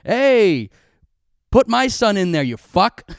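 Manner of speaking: loud, high mock demand; hammed-up dad barking down the phone; mock angry dad. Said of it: "a call from my dad being like".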